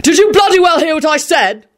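high-pitched, exasperated demand; angry shout. Acted. Quentin to the whining mob, angry shout. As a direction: demand it furiously, pitched right up. Shouting man repeats ignored order.

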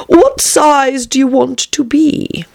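high, slow, drawn-out caterpillar question; languid caterpillar. A narrator's reading. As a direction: ask it loftily, stretching each word.

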